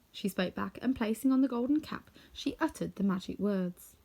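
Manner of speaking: ordinary delivery, nothing marked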